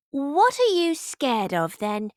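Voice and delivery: a hint of a curious prince; lightly voiced boy. A narrator's reading. Leopold asking Noah lying in the grass, curious. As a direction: ask with boyish curiosity.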